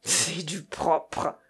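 slow, drawn-out scorn in a character voice; low-pitched, slow acted indignation; disgusted exclamation. A narrator's reading. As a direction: draw it out with disgust. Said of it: "s'écria monsieur Florent".

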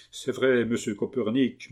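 ordinary delivery, nothing marked

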